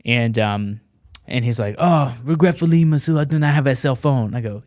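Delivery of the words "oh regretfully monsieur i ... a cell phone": apologetic put-on French accent; French accent impression. French reporter denying he has a phone, French accent. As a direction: refuse regretfully in a French accent. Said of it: "I could do an impression".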